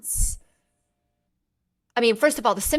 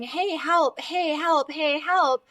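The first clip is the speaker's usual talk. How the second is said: body calling for help; 5 semitones higher, slower than usual. Pretend. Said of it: "our body's saying".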